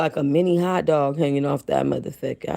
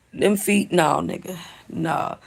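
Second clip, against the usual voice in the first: normal talking voice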